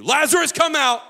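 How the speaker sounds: high, explosive call; commanding shout. Acted. Jesus at the tomb calling out the dead man, full shout. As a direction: shout it as an order.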